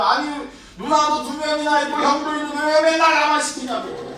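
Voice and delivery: put-on high, whining child's grumble; whiny annoyed complaint. Little boy whining to mom about errands, high and annoyed. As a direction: sulk and protest like a kid sent on errands. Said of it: "저는 짜증을 내요".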